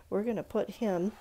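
normal talking voice

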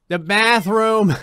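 loud, high, drawn-out insistence; insistent demand. Acted. Cat desperate for the bathroom, loud drawn-out demand. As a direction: stretch it out as a hammed-up demand. Cat's demand repeated for emphasis.

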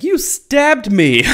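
high, loud, drawn-out outrage; hammed-up shriek of betrayal; mock appalled. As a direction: cry it out slowly, aghast, nearly laughing. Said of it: "appalled that he just stabbed him".

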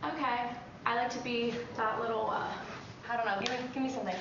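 ordinary delivery

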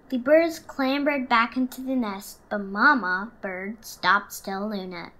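put-on lively storybook narration for children; story reading. A narrator's reading. Reading Stellaluna to the class, warm storytelling voice. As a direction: read it like story time for kids. Reads picture book aloud.

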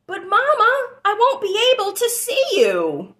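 a small calf close to tears; little one upset. A narrator's reading. Child protests to mother, high and upset. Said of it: "little one protests".

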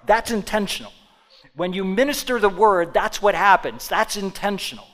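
roaring pulpit delivery; full-force preaching. Acted. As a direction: preach it to the back row.

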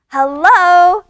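very loud, high hello; yelling over noise. A narrator's reading. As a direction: shout into the phone over a racket. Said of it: "she yells over the other noise".

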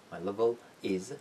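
"My level is" slowly, with "is" separated from "level"; slow word-by-word "My level is"; unlinked words. Shown as a demonstration. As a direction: finish "level" on dark L, then start "is" afresh.

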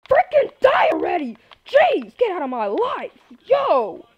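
high, loud frustrated yell; exasperated, high-pitched yelling; angry shouting. Real, not acted. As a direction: shout at an enemy that won't die. Shouting at attacking mobs.

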